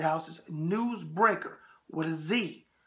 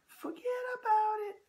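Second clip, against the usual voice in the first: high mock imitation of his daughter's forget about it; imitating Debbie. Host mimics Debbie's intro line, raised playful voice. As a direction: echo Debbie's catchphrase in a raised voice. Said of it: "that sounded like I was doing Santino's voice".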